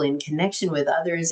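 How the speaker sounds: normal talking voice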